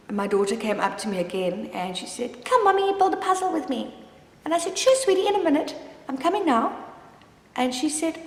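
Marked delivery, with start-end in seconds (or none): 2.4-3.9 put-on high, light child voice asking sweetly; little daughter's voice. Mother voicing her little daughter asking to play, high child voice. 4.8-6.8 higher, sing-song reassuring mom voice; sweet mom reply. Retold or imitated. Mother retelling her reply to her daughter, higher sweet reassurance.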